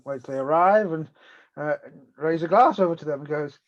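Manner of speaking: ordinary talk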